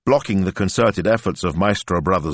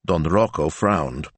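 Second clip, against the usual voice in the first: ordinary delivery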